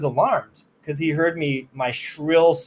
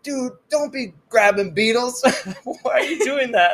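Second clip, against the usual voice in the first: laughing tease; 9 semitones higher than usual. Retold or imitated. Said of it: "you're laughing at me".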